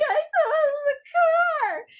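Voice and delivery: very high, drawn-out mock wail; imitated crying. Imitating mom confessing the crash, crying. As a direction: squeal it through fake tears. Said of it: "she's crying, freaking out".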